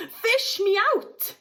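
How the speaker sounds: high, slow voice unlike the narrator; boy pleading with his parents from the river. A narrator's reading. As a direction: beg to be pulled out. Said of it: "he yelled".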